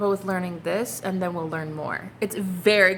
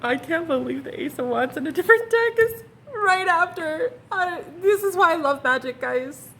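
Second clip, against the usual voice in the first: high, laughing-crying disbelief; overjoyed; 9 semitones higher, 3 dB louder than usual. Real, not acted. Reader overcome by the repeated card, laughing through tears. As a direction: gush with tearful delight. Overjoyed at Ace of Wands twice.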